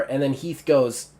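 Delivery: usual speaking voice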